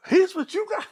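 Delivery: can't believe it; incredulous. Retold or imitated. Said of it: "They go".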